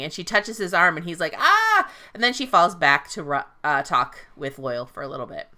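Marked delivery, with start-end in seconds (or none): 1.4-1.9 sharp, high mock yelp when touched; startled yelp. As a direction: jump at her touch with a quick cry. She touches his arm, he yelps.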